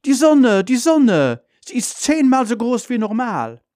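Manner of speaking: very high, loud cry of amazement; calling out. A narrator's reading. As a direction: shout out the alarming sight. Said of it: "Sie ruft". (aufgeregt, sehr hoch und laut gerufen)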